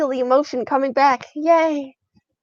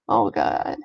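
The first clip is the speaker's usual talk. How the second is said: usual speaking voice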